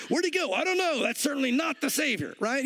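high-pitched comic confusion; hammed-up puzzled bystander voice; mock bewildered onlookers. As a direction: play baffled bystanders, voice pushed up high. Acts out baffled onlookers after escape.

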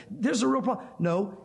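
ordinary talk

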